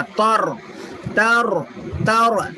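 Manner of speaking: तर as a rounded "tor", thick trilled r; heavy r. Shown as a demonstration. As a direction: say तर full-mouthed on the r. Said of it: "तो मोटा पढ़ना रॉ को".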